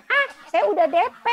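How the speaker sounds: high, loud and fast gleeful announcement; gloating that the deposit is already paid; triumphant. Retold or imitated. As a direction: crow that you won.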